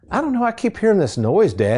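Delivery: playing his twelve-year-old self, puzzled and unsure; young son worried. Boy in the passenger seat tells his dad about a noise, puzzled kid voice.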